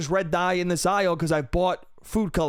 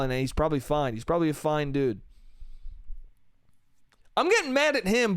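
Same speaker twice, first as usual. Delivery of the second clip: ordinary talk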